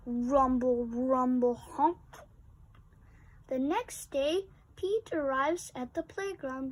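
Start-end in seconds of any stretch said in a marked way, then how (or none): 0.1-2.0 louder rumbling truck sounds; truck noise imitation. A narrator's reading.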